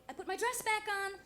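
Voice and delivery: usual speaking voice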